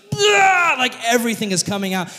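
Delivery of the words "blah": high loud blubbering wail; mock sobbing blurt, high. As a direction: burst out in a hammed-up cry. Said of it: "He's like, just".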